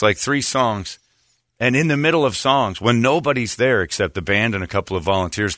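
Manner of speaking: usual speaking voice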